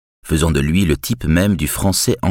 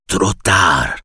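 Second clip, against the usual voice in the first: growling; 2 semitones higher than usual. A narrator's reading. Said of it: "gronda le vieux".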